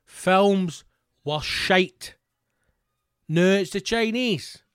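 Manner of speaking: ordinary talk, nothing marked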